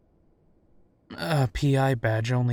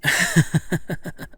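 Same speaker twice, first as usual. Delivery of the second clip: short laugh; 5 semitones higher than usual. Acted. Amused laugh on phone call.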